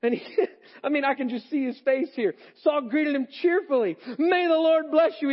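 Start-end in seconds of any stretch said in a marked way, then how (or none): 2.6-4.0 much higher and louder, beaming with cheer; cheerful excited. Acted. Saul greeting Samuel, sure he did good, excited and cheerful. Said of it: "He's excited". 4.2-5.3 much higher and louder, eager and beaming; excited greeting. Acted. Saul hailing Samuel proudly, excited and high. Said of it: "He's excited".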